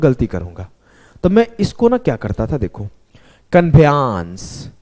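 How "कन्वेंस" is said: कन्वेंस said very slowly as "kanbheyans", spelling-style; deliberately mispronounced. Shown as a demonstration. Teacher showing students a spelling trick, slow, deliberate mispronunciation. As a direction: drag out कन्वेंस as "kan-bhe-ans" to hear the letters. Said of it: "जानबूझ के इसका pronunciation अपने हिसाब से ख़राब".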